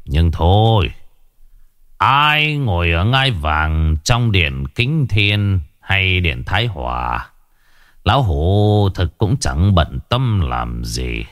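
put-on slow, drawn-out old master's speech; lofty, unhurried dismissal; serene old sage. Old recluse tells the Vương father and sons thrones don't concern him, unhurried.

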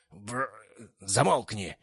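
lisping snap to shut up; snapping. A narrator's reading. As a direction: cut him off harshly. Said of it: "шепелявый".

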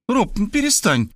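put-on high-pitched, mildly annoyed girl; cross young woman. A narrator's reading. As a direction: tell him off lightly in a high voice. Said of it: "рассердилась Варвара". (высокий девичий голос, слегка сердито)

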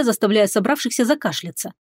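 ordinary delivery, nothing marked

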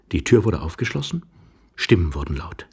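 ordinary delivery, nothing marked

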